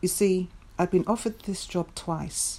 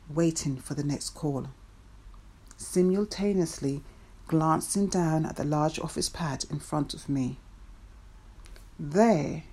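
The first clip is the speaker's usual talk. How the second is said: ordinary delivery, nothing marked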